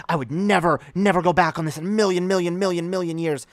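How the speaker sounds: hammed-up vow, high, loud and emphatic; over-the-top self-convincing. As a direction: pile on the denial, mock-desperate. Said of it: "they have to convince themselves that".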